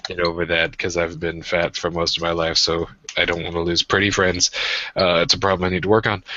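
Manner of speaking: ordinary delivery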